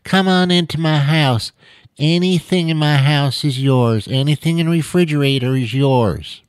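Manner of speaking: overly sweet welcome to a guest; mock sweet hospitable person; sugary sweet. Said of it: "People can be so sweet".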